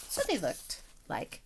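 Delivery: soft secret whisper; a hushed aside to the listeners. A narrator's reading. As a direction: lean in and breathe the words.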